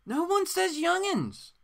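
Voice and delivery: high, slow scoffing protest; mocking disbelief. Real, not acted. Host mocking the hillbilly dialogue, high and slow. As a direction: drag it out in mock outrage.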